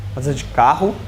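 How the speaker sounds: "carro" com R aspirado, como rr carioca; R regional. Shown as a demonstration. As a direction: diga "carro" com o R forte na garganta. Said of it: "com a palavra R". ("carro" com R gutural)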